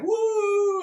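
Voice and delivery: loud, very high drawn-out "woo"; retold carload whoop; whooping cheer. Everybody in the car celebrating, whooping. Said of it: "everybody in the car's like".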